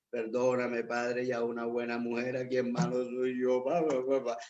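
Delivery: tearful prayer imitated in a low, droning voice; mock crying. Husband praying to God after venting about his wife, mock crying. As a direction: blubber softly into babble, acting out your own weeping. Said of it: "empiezo yo a llorar".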